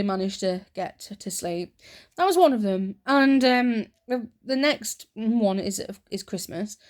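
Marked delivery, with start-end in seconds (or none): none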